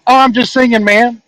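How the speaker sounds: lightly acted nonchalance; breezy excuse. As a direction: shrug it off, playing innocent. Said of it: "I'm like".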